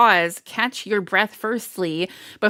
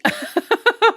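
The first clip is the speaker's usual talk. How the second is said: giggle; 14 semitones higher, 4 dB louder than usual. Real, not acted. Laughs mid-sentence.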